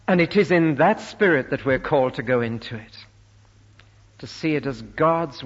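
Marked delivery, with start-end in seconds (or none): none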